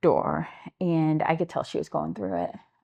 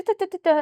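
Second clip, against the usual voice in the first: high, louder run of da-da-da; imitated chatter; 14 semitones higher, 5 dB louder, faster than usual. Advocate imitating a girl who just started talking, high mock chatter. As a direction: mimic someone talking in a rush of nonsense syllables. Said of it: "she just started talking".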